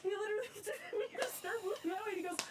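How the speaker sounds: high, breathless, giddy laughter through the words; laughing excitement. Real, not acted. Rail fan telling the camera after driving the engine, giddy laughter. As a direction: gush it out while laughing. Repeat of same clip.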